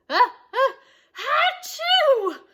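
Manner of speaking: high, loud achoo build-up; loud sneeze. A narrator's reading. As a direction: sneeze it big. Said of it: "repeated the fans".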